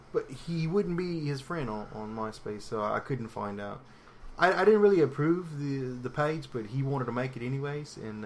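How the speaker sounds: put-on halting British accent drifting towards Australian; dodgy British accent. Podcaster telling an embarrassing crush story, Australian-sounding British accent. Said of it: "this is my British accent".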